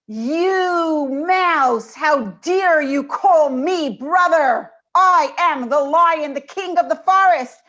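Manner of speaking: loud, slow, booming king of the forest; pompous lion bellowing with wounded pride; haughty angry lion. Retold or imitated. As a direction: roar down at the tiny mouse how dare he. Lion rebukes mouse in joke.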